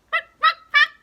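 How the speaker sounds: very high, loud yaps; dog barking. Shown as a demonstration. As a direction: yap sharply like a small dog. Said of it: "Is that what a dog says?".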